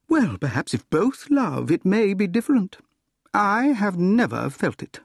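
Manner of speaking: high-pitched woman's voice, demurring; acted heroine, gently resisting; Gladys, doubtful. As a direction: answer him in a lifted, reasoning pitch.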